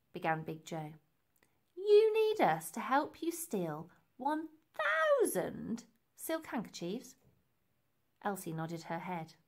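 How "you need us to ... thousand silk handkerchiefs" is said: higher, slow, disbelieving; a slow, incredulous gang boy; Big Joe's voice. A narrator's reading. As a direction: spell it out in disbelief. Big Joe voiced in story.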